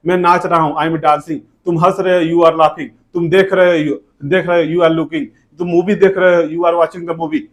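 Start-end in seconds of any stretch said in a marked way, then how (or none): none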